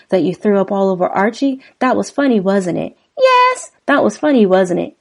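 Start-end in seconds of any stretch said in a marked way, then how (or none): 3.2-3.7 high falsetto woman's voice; Kim voice. Acted. Kim's lines voiced in lyric.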